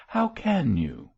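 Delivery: a touch of scandalised protest; shocked cry. A narrator's reading. Mab and Gabriel rebuke George's callous joke, shocked. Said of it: "cried Mab and Gabriel in a breath".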